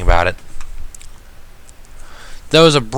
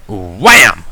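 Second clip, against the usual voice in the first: wham; 2 semitones lower, 6 dB louder than usual. A narrator's reading. Impact sound word in narration.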